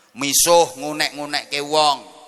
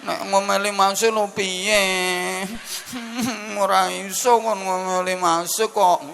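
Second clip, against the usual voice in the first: high, slow whimpering wife impression; hammed-up sobbing between drawn-out words; mock crying; 4 semitones higher than usual. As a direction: play a helpless tearful wife, pitched up. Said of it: "malah nangis kok".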